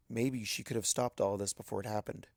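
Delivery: usual speaking voice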